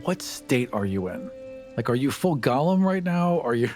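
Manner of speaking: ordinary talk, nothing marked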